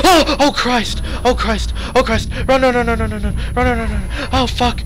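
high frantic yelling in terror; panicked screaming. Real, not acted. Ghost attack in horror game.

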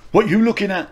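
high-pitched mimicked kid's challenge; cocky challenge. Retold challenge from a kid.

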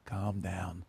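hushed, low "calm down"; quietly placating; soft calm-down. Retold or imitated. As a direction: say it low and soft, soothing someone worked up. Demonstrates the triggering phrase.

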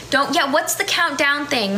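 normal talking voice

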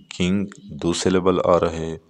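usual speaking voice

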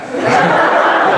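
short breathy laugh; a quick airy laugh. Real, not acted. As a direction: laugh at your own story. Laughs at her own story.

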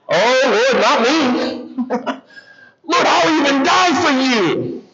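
high, emphatic mock protest; bold protest. Peter answers Jesus at the Last Supper, boldly protesting. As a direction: imitate an over-eager vow, pitched well up.

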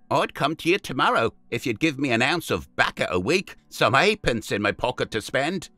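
put-on young street boy naming his price; cheeky street boy. A narrator's reading. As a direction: haggle like a cocky London lad. Jim bargains with his rescuer.

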